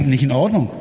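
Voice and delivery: ordinary talk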